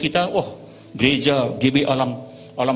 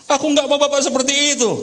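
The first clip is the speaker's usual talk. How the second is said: high, mock-tearful protest; hammed-up child's wail; acted crying child; 12 semitones higher, 4 dB louder than usual. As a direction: play a daughter wailing at her father. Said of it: "Tambah nangis anaknya".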